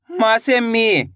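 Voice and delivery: very slow, with मी held long; slow demo. Shown as a demonstration. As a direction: stretch it out for the learners. Teacher demonstrating syllable change. (बहुत धीरे, मी को खींचकर)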